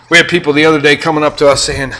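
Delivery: ordinary delivery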